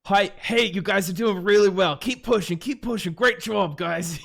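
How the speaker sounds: loud, high, over-positive team cheerleading; encouraging shot caller. Retold or imitated. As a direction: call out upbeat praise to the squad like a shot caller. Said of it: "What did those shot calls sound like?".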